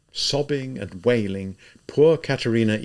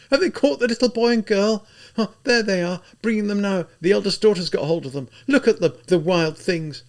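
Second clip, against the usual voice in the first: higher, loud woman's prayer; a woman crossing herself; pious woman; 11 semitones higher, 4 dB louder than usual. A narrator's reading. Onlooker woman.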